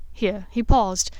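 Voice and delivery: ordinary delivery, nothing marked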